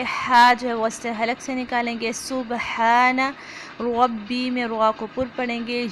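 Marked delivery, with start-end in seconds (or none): 2.1-3.3 सुबहाना slow, with a bouncing qalqala on the बा and the हा from mid-throat; tajweed demo. Shown as a demonstration. Teacher models सुबहाना for students, slow with qalqala on बा. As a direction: say सुबहाना slowly, echoing the बा and breathing the हा from the throat. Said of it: "सुबहाना में बा पे कलकला करेंगे".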